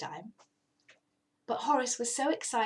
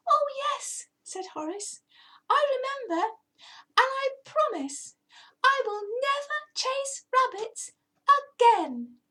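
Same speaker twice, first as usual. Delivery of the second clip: very high, louder earnest vow; earnest cat promise; 4 dB louder than usual. Acted. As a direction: swear it solemnly.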